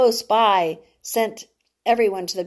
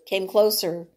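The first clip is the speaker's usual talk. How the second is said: ordinary delivery, nothing marked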